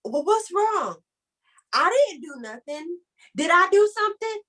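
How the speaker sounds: mock defensive boyfriend; clueless man. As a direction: play the flustered man who blames himself. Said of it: "one thing to not do when your queen cries".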